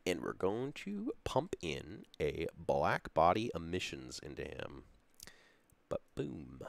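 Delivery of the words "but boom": whispered little boom. Real, not acted. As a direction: breathe the word out. Whispered reveal.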